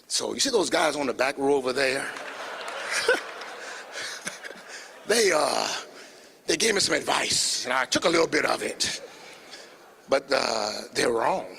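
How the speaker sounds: playing Skip rallying the crowd; mock coach talking to a crowd; lightly imitating Skip. Skip addresses crowd, retold.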